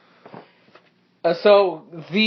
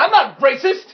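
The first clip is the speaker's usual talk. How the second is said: higher, indignant mock denial; loud protest; 7 semitones higher, 5 dB louder than usual. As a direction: protest it to everyone around. GM voices accused director.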